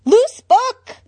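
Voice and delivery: high, loud outraged cry. A narrator's reading. Nigma tackling Zatanna, crying out loud. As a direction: yell it as he tackles her.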